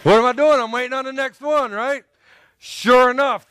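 ordinary talk

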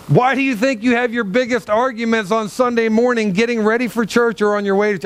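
ordinary talk, nothing marked

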